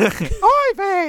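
high cartoon Yiddish accent. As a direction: squeak out oy vey in a Yiddish accent. Echoing oy vey higher.

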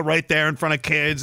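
normal talking voice

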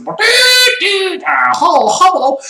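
imitating a Chinese-accented gadget voice; high, mock Chinese accent. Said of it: "it talks in a Chinese accent".

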